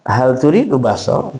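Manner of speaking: "Hal turidu baso" rising at the end; questioning lift on "baso"; full question intonation. Shown as a demonstration. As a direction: ask "Hal turidu baso", lifting the last word. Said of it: "Kan gitu kan biasanya".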